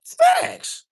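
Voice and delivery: a sudden high yelp of a word; shouted facts. Real, not acted. Capping his rant to the chat with a high shout. After ranting at commenter.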